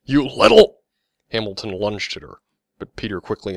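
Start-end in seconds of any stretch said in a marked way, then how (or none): 0.0-0.8 high, loud shout, cut off; furious outburst. A narrator's reading. Infuriated Mister Hamilton lunging at Vicky, furious outburst. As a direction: explode in rage, breaking off mid-phrase.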